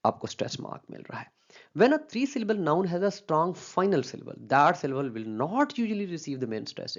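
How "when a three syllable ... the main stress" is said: slow, careful textbook reading; reading a rule aloud. Acted. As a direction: read the rule out slowly, word by word. Reads rule from slide slowly. (धीमी आवाज़ में नियम पढ़ना)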